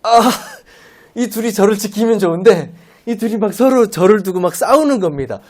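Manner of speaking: usual speaking voice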